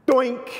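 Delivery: sharp high loud "doink" sound effect; ball hitting board. Retold or imitated. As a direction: pop out the bounce noise, high and loud. Tennis ball hits the board.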